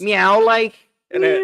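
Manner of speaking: high, loud cat meow in a Scouse lilt. Pretend. To his co-host about the cat, a Scouse meow. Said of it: "She sounds proper Scouse when she meows".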